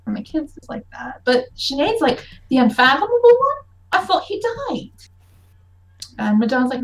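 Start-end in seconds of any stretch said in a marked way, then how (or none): 2.5-4.9 surprised. Acted. Sinead surprised by news.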